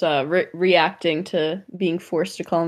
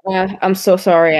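normal talking voice

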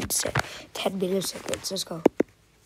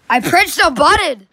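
loud, higher exasperated protest; frustrated; 5 semitones higher, 9 dB louder than usual. Real, not acted. Arguing with the game that failed, loud frustrated protest. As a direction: complain at the screen, indignant.

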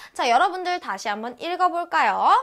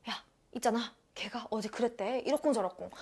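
very soft, confidential whisper; whispering gossip; 4 semitones lower, 11 dB quieter than usual. Acted. Friend passing on yesterday's gossip, in an acted whisper. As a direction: pass on a secret under your breath. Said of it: "기속말로".

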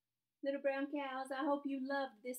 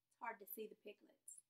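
plain reading voice

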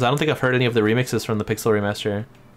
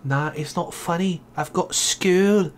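mock Scottish accent, rising higher; Scottish accent attempt; 5 semitones higher, slower than usual. Gamer warming up a dwarf voice, mock Scottish accent. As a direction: ham up a practice Scottish accent. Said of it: "How do you do a Scottish accent?".

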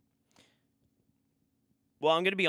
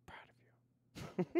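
slow, higher breathy whisper of "Proud of you"; mock tender whisper; 5 dB quieter, much slower than usual. Streamer teasingly praising a chatter's thesis, slow high whisper. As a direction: whisper it slowly and higher, hamming up the pride. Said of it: "I'm proud of you".

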